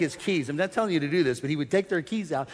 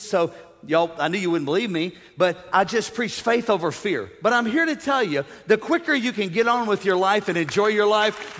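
ordinary delivery

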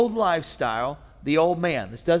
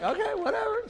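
mock dismissive wife; 6 dB quieter than usual. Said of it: "So she's like".

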